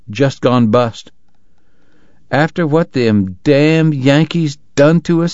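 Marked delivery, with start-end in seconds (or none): 2.3-5.3 higher, slow resentful drawl; grumbling Southern stranger in a telegraph office; bitter Southern townsman. Acted. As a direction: spit it out like a sore Confederate. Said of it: "someone remarked".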